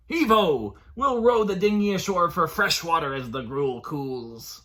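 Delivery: loud hearty pirate call; pirate papa. Acted. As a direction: boom it out like a sailor.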